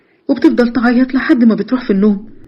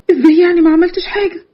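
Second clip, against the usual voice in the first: high, upset challenge; distraught disbelief; 7 semitones higher than usual. Acted. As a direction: throw his denial back in alarm. Victim challenges his denial.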